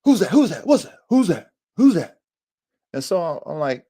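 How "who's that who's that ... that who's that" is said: high, rapid-fire yips in words; translated dog bark. Retold or imitated. The other dogs are doing it too, imitated barking. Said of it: "if I could translate what a bark is".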